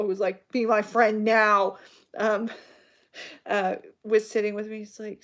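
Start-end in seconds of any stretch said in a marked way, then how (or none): 0.5-1.8 slow, louder, deadpan order; demanding goth girl. Retold or imitated. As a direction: command friendship, half joking. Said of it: "crazy, pink-haired goth girl".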